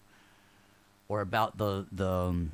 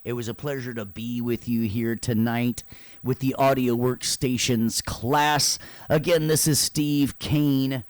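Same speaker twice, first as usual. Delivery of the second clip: loud, projected show-host farewell; announcer-style sign-off; 3 semitones higher, 7 dB louder than usual. Acted. Host thanks everyone for stopping by, announcer voice. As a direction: wrap up the show in a big announcer voice. Signs off the class.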